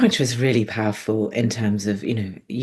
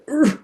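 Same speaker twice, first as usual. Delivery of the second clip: mock choked grunt; 12 semitones higher than usual. Lungs seizing up, voiced groan.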